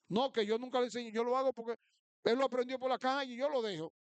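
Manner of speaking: quick, high-pitched mock self-justification; defensive parent. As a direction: mimic a parent hurrying out an excuse. Acted excuse of lax parent.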